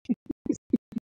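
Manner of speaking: high amused laugh. Real, not acted. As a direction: burst of high-pitched laughter. Recalling drunken afternoon.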